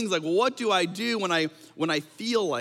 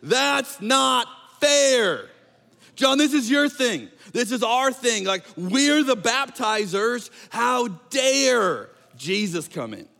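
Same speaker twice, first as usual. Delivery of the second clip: put-on high, loud, slow childish protest; indignant whine; 6 semitones higher, 3 dB louder, slower than usual. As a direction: whine it like a wronged kid. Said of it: "what they're saying in adult form is".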